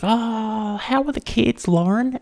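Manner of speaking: high, drawn-out sing-song mock chatter; overheard phone-call lady. Comic mimicking an overheard lady on speakerphone, high mock voice. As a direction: put on a high voice for small talk.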